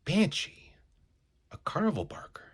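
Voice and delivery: a surprised, hushed whisper. Acted. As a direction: keep your voice down so nobody hears. Spotting the barker while hiding.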